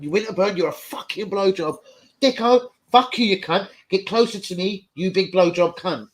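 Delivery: high, loud imitation of berating teammates; threatening rant. Furious defender berating his teammates, acted threatening rant. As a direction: tear into them in a hammed-up rage. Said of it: "still probably threatened Dixon".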